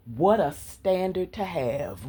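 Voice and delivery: ordinary talk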